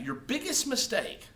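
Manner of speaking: ordinary talk, nothing marked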